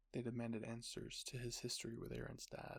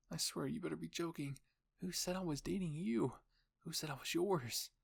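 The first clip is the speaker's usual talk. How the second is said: higher, louder outraged questions; indignant; 5 semitones higher, 5 dB louder than usual. Acted. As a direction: protest in rising disbelief, voice jumping up. Outraged denial of dating Aaron.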